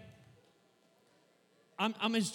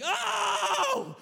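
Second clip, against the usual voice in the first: high, loud joyful shout; excited yell; 13 semitones higher, 7 dB louder than usual. Retold or imitated. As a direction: yell out like the home team just scored. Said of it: "I just yelled".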